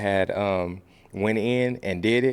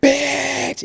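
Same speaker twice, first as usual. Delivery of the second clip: a much higher, lighter echo of "Bitch."; high group echo; 4 dB louder than usual. Shown as a demonstration. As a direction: toss "Bitch." back up high like the whole class answering. Class echoes the shouted word.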